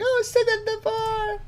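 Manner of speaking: very high squeaky mock girl voice; mock decoy girl. Imitating the decoy's response to the visitor, mock high girl voice. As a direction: put on a high-pitched girly singsong. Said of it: "she just disappears".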